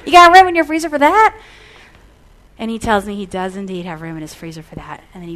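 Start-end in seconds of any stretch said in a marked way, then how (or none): 0.0-1.3 excited pitch. Retold or imitated. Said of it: "I'm like".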